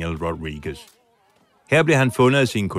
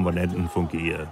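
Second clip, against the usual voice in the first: normal talking voice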